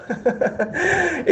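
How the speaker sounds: short high scornful laugh; mocking laugh. Real, not acted. Debater laughing at the silent opponent, mocking laugh. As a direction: laugh at him, soft and high.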